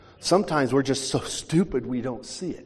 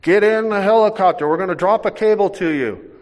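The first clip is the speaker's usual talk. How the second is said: louder, higher yelled order; shout over helicopter; 6 semitones higher, 9 dB louder than usual. Retold or imitated. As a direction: yell over the rotor noise.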